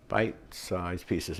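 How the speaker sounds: slow, soft, separated "Bite-sized pieces"; "Bite-sized pieces" drawn out quietly and carefully; careful tongue twister. Shown as a demonstration. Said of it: "tongue twister".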